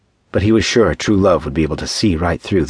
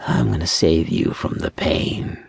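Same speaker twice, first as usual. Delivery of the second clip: slow, sinister whisper; menacing zombie whisper; 3 dB quieter than usual. A narrator's reading. Zombie to the cornered man he will kill, whispering menace. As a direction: whisper it slowly like a creeping killer.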